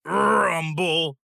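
low rumbling sound word; tummy rumble. A narrator's reading. Narrator imitating a growling tummy, low rumble.

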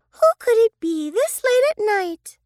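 put-on high puzzled child voice; lightly voiced girl. Sister hearing a knock at night, high curious girl. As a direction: wonder who knocks at night.

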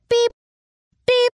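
short electronic-style beep, evenly repeated; radar beeps. Acted. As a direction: imitate a scanner beeping at steady intervals. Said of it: "starting the radar scanning".

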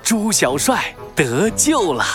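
usual speaking voice

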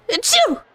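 sneeze. Acted. Dog's allergy acting up.

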